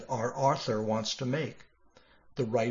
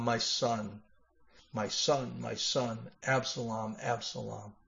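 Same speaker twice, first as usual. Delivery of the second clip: softer, sinking into quiet grief; grief fading; 3 semitones lower, 8 dB quieter than usual. Acted. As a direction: let the lament die away to almost nothing. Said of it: "he cried".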